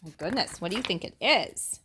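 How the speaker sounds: ordinary delivery, nothing marked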